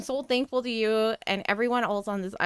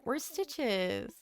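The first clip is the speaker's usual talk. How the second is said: ordinary talk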